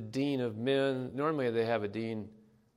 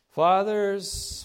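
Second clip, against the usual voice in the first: loud high call of the word; 9 semitones higher, 9 dB louder than usual. Shown as a demonstration. Preacher to congregation, calling out loud and high. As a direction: invite the congregation to finish the verse. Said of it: "No one wants to say that word".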